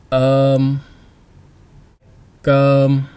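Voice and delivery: Um and gum said loud and clear; teacher stressing um and gum for learners. Shown as a demonstration. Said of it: "Short, long".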